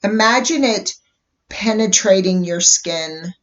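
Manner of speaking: gentle guided meditation voice. Acted. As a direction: ease listeners into calm with long pauses. Leads a guided color meditation.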